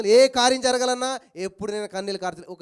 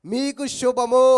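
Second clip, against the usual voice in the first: slow, loud, drawn-out blessing; a slow, loud greeting from Jesus; solemn blessing; 2 semitones higher, 9 dB louder, much slower than usual. Acted. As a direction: bless them slowly and grandly. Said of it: "ఈయన ఎదుర్కొని ఏమంటున్నాడు?".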